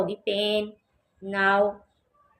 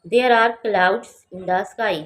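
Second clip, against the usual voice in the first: very slow and louder, words spaced; slow, loud model reading; 6 dB louder, much slower than usual. Shown as a demonstration. Teacher modelling the sentence loudly and slowly for students. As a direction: read it out slowly and loudly for learners. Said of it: "teacher models sentence".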